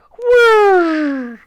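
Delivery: loud, high-pitched whirring noise; high imitated whirr. A narrator's reading. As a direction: voice a speeding object whizzing by. Narrator imitates the speeding ram.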